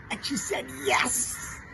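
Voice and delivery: high, thrilled cheer; overjoyed. Acted. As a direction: burst out with joy. Role play, joyful yes.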